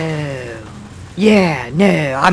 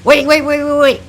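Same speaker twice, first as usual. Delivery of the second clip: panicked; 12 semitones higher, 6 dB louder than usual. Acted. Panics about bursting into flames.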